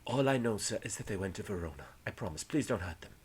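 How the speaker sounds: soft, hushed confession; meek captive giving in; timid pleading. Acted. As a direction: beg quietly for their safety.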